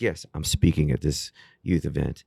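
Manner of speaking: deep, measured professional man's voice; James. Retold or imitated. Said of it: "He has this really deep voice".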